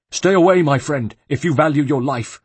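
high, loud panicked monk warning; a scared monk waving a stranger off, urgently; frightened monk. Acted. A fleeing monk warns Ezio away, high and frightened.

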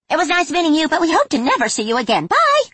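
very high, fast, loud goodbye; chirpy fairy farewell. Acted. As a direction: rattle it off brightly. Fairies bid farewell.

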